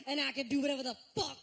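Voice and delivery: hamming up a rambling R&B star on the mic; R. Kelly impression. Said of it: "He talks like R. Kelly talks. So he goes like this".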